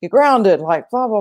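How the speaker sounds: high mock scolding, tailing into blahs; retold angry yelling. Mother punishing her son in a retold scene, mock yelling. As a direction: imitate a parent yelling out a punishment. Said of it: "she's yelling at the".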